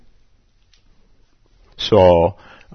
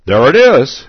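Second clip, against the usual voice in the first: loud, high triumphant exclamation; teacher's loud joyful exclaim; 7 semitones higher, 10 dB louder than usual. Acted. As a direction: call it out like a sudden discovery. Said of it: "exclaimed the teacher".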